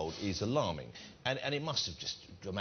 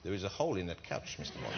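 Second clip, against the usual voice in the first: Bond villain voice; 4 semitones lower, 5 dB quieter than usual. Pretend. Host plays a Bond villain.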